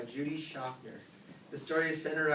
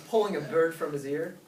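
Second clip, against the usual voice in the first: ordinary delivery, nothing marked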